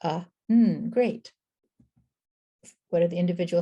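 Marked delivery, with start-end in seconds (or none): 0.0-0.9 short "Uh", then a held n for "mm"; sounded out. Shown as a demonstration. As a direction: separate the sounds "Uh, mm". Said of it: "individual sounds you hear in un".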